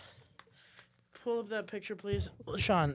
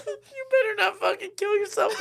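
ordinary delivery

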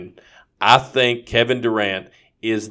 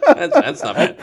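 laughing; 5 dB louder than usual. Real, not acted. Laughing at the impression.

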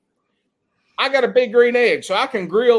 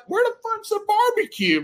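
pitched high, mock disbelief; incredulous question; 11 semitones higher, 4 dB quieter than usual. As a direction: play the let-down guest in high, mock shock. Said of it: "And I'm like".

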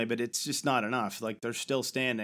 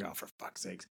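exasperated mutter; 6 semitones lower, 14 dB quieter than usual. Retold or imitated. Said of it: "She's like".

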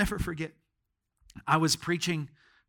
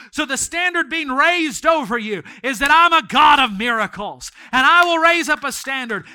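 loud, higher preaching; full-force preaching; 5 semitones higher, 8 dB louder than usual. Acted. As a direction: preach it out with fire.

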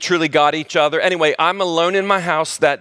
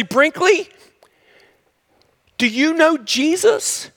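much higher, slow, wondering question; amazed; 10 semitones higher, slower than usual. Retold or imitated. Owner amazed his dog reacts to Jesus, high and wondering. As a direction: marvel at the dog.

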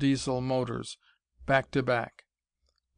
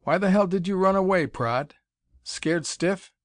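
louder, higher, fast angry accusation; bursting out; 6 semitones higher, 3 dB louder than usual. A narrator's reading. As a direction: fling the accusation at him. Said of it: "snorted and burst out".

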